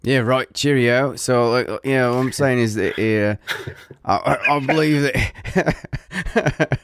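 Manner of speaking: badly faked British accent, breaking down at the end; put-on British accent. Podcast host jokingly attempting an accent for co-hosts, mock British. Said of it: "maybe I'll try my Scottish accent".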